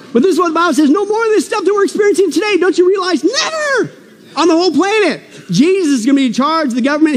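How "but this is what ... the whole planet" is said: preaching build. Acted. Preaching at full force.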